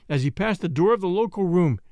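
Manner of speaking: usual speaking voice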